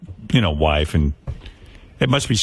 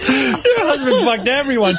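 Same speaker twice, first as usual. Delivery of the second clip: laughing mock shock; 13 semitones higher, slower than usual.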